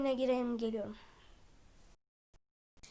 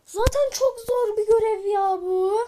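whiny despair; 7 semitones higher, 6 dB louder than usual. Real, not acted. Gamer despairing over hard mission.

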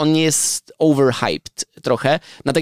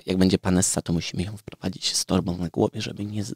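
whispering; 6 semitones lower, 6 dB quieter than usual. Real, not acted. Hushed joking about masked guest.

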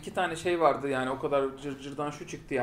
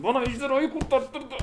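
higher, slow whining, laughing through it; whiny mock Clara; 8 semitones higher, 3 dB louder, slower than usual. Fan mocking Clara's sulking over the dragon, whiny and laughing. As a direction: sulk like a spoiled child. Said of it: "Eve gidip yastık mı yumrukladın?".

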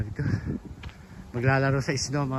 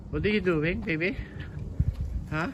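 higher, sweet pet voice; 7 semitones higher than usual. Real, not acted. Owner asking her dog what it's doing, cooing. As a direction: coo at the dog.

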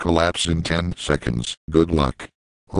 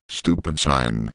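normal talking voice

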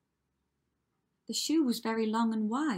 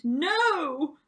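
loud, high scream of no; screamed refusal; 8 semitones higher, 10 dB louder than usual. A narrator's reading. Prince as the ugly sister tries the shoe, screamed refusal. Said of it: "The prince screamed".

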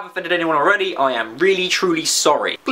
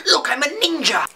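mock ninja; 8 semitones higher than usual. Silly ninja skit.

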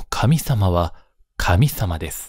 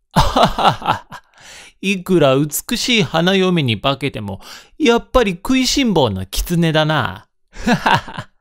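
high laughing taunt at the fox; mocking laugh, high; 8 semitones higher than usual. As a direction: laugh and jeer from higher up. Onlookers laughing at exposed fox. (高い声で笑いながらからかう)

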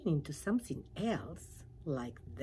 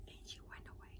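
soft unvoiced whisper; hushed narrator whisper; whispered aside; 17 dB quieter, much faster than usual. A narrator's reading. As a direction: drop to a whisper as she leaves.

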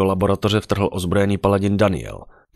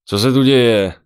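loud, higher-pitched calling question; raised-voice demand to know; called from doorway; 6 semitones higher, 9 dB louder than usual. A narrator's reading. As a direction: call out across the room. Called from the door.

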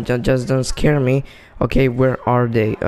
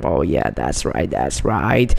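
a playful low growl of triumph; mock deep voice; 10 semitones lower than usual. Gamer gloating over a kill in a low mock voice. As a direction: drop into a much lower put-on voice, gloating. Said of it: "Look at that, my voice".

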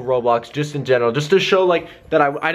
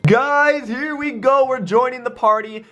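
high-pitched excited announcement; rally the viewers, pitch way up; hyped; 9 semitones higher than usual. Real, not acted. Prankster telling viewers the party join worked, hyped.

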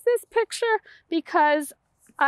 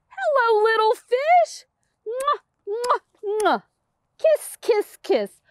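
higher loving mom fish with kisses; shower the little one with kisses; mother fish, lightly voiced; 7 semitones higher, slower than usual.

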